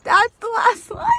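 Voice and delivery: shouted disbelief. Real, not acted. Reader shouting in disbelief at the book's final line. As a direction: yell it in tearful outrage.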